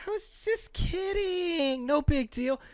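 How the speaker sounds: high, slow ditzy drawl; mock influencer voice. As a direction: drawl it airy and careless. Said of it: "I'm like".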